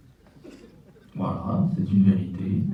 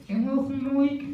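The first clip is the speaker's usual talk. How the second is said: goofy muffled sock puppet; 13 semitones higher than usual. Acted. Puppet adds a joke about itself.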